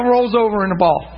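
ordinary talk, nothing marked

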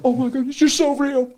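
high-pitched mock freak-out. New convert exclaiming to God, freaking out. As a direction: re-enact being overwhelmed, voice leaping up high. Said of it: "freaking out".

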